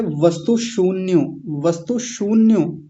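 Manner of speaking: वस्तु शून्यो slow, with a long ū in शून्यो; वस्तु शून्यो said carefully for learners; correct pronunciation demo. Shown as a demonstration. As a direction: stretch the ū of शून्यो. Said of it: "शून्य होता है, बड़े ऊ की मात्रा".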